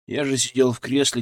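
ordinary delivery, nothing marked

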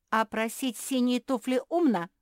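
loud, high challenge; combative. A narrator's reading. Капитолина attacking her sister's wish, combative. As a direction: fire the question back, ready to fight. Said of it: "кинулась в бой Капитолина".

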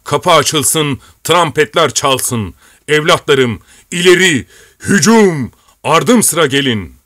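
rally the soldiers into the attack; battle shout. A narrator's reading. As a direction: commander shouting the charge to his men. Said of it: "diye bağırdı".

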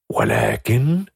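ordinary delivery

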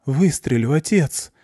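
put-on girl threatening her father, voice shaking; frightened daughter forcing out a threat; trembling voice. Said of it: "Голос Блонды дрожит".

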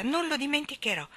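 high-pitched, trusting promise; confident. A narrator's reading. Baby Annabella promises to remember, confident. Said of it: "disse fiduciosa".

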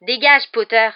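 loud annoyed snap; irritated. Acted. Rival telling Harry to get out of the way, loud and annoyed. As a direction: shove him off with your voice. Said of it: "lança Malfoy agacé".

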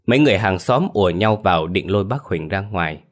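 plain reading voice

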